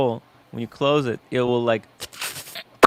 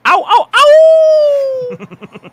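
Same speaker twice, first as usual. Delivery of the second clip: high, loud pained yelping; mock dog yelp; 11 dB louder, much slower than usual.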